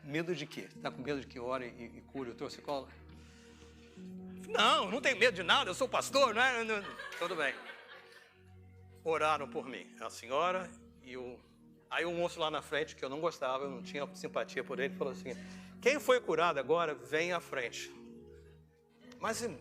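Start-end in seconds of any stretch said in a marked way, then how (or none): none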